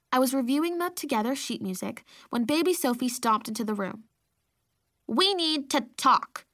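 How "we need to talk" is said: put-on slow, high little-girl bark; cute little bark. A narrator's reading. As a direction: bark it like a tiny boss. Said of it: "she barked at me in her cute little voice".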